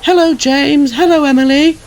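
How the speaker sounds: high, loud cheery greetings; beaming, high-pitched hellos; proud puffing. A narrator's reading. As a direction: greet everyone in a proud little voice. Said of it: "puffed Percy proudly".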